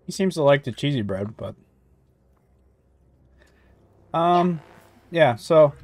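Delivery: speaking between bites of pizza; talking while chewing. Real, not acted. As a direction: mouth partly full of food. Seen 1: holds pizza crust near mouth, eating between bites while talking.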